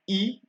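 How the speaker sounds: E as a high "ee"; French I sound. Shown as a demonstration. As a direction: say E like English "ee". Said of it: "it's pronounced E".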